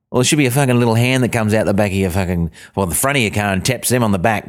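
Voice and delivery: usual speaking voice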